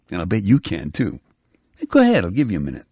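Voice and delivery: ordinary talk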